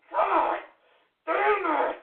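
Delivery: high-pitched, exasperated curse; frustrated outburst. Real, not acted. Host at the end of their patience, frustrated outburst. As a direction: curse in strained frustration, word by word.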